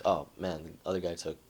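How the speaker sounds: low, soft acted disappointment; understated mock dejection; mildly let down. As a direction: sink into a quiet, half-hearted regret. Imagined player regrets the loss.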